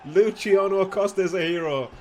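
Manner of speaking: shouting with joy; excited shout. Real, not acted. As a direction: yell it out in triumph. Goal celebration commentary.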